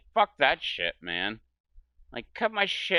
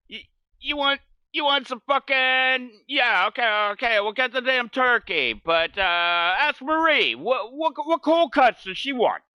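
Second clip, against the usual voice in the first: New Jersey voice; 7 semitones higher than usual. Retold or imitated. Acting out Jersey guy.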